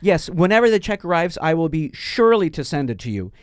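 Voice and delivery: lightly voiced, overly polite American customer; mock proper American voice on the phone. Caller faking an American voice for the scammer, overly polite. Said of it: "I'm speaking like this".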